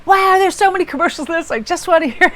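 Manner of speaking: high-pitched, exasperated whine-yell at oneself retold; mock angry rant. Driver mad at too many podcast ads, mock angry outburst. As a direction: burst out high in mock outrage. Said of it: "I got so mad".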